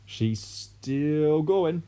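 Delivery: very slow, put-on gleeful voice; father gloating over shrinking grandma; gleeful shout. A narrator's reading. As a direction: relish every word. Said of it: "shouted Mister Cranky gleefully".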